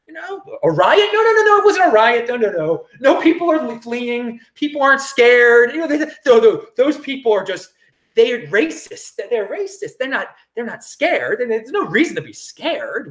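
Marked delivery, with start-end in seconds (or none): 0.1-11.4 high, mocking sing-song denial; higher and louder mock insistence, slowing on the punchlines; sarcastic mockery. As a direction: play the naive denier with heavy sarcasm.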